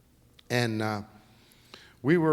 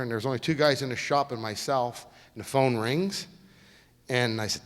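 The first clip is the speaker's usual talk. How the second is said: ordinary talk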